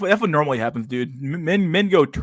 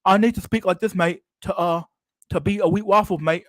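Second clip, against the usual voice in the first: higher mocking British accent, "mate" tacked on; 6 semitones higher, 3 dB louder than usual. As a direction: lampoon him in a put-on British accent. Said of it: "I gotta put my, my accent on, mate".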